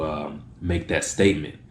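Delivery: ordinary talk, nothing marked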